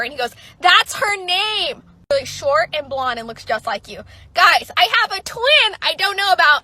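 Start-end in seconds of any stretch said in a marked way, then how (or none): 0.6-1.8 much higher, louder and slow; emphatic reveal. Retold or imitated. Guy reveals the doppelganger. 4.3-6.6 much higher and louder to the viewers; excited outburst. Real, not acted. Shocked at having a twin.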